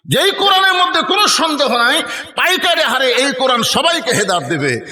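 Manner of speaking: high, ringing proclamation to a crowd; full-force preaching. Real, not acted. Preacher assures the crowd the Quran guides everyone, full-force preaching. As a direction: proclaim it high, then ease down slightly.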